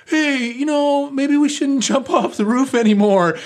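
very high, loud squeaky falsetto; mock nervous suggestion. Shaken friends calling down, acted out.

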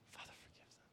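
breathy whisper of a dying man; hushed plea from the cross; whispered prayer. Acted. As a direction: whisper it, pleading. Jesus's prayer voiced on cross.

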